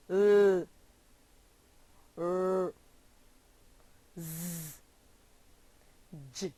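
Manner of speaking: B, D, G, Z, V, L, R, Z, J said as single voiced sounds, not letter names; isolated voiced sounds. Shown as a demonstration. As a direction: sound out each voiced consonant alone with pauses between. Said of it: "Repeat after me".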